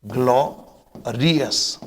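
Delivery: ग्लोरियस slowly split, 'glo... ri-us'; demonstrated pronunciation. Shown as a demonstration. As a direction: break ग्लोरियस after 'glo', then 'ri-us'. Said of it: "इस तरीके से". (ग्लोरियस को तोड़कर, 'ग्लॉ... रियस')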